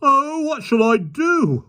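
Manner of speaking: high, slow panicky wail. A narrator's reading. Park keeper panics at the lion, slow high wail. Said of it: "wailed the park keeper".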